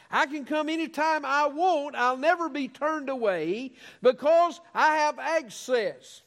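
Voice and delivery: high, ringing sermon cadence with falling phrase ends; fervent preaching. Real, not acted. Preaching with rising fervour.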